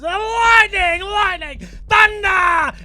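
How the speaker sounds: high, full-volume drawn-out yell; wildly overdone high-pitched shouting; shouted lightning call. Acted. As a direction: bellow it like a madman mid-joke. Man in joke shouts weather words.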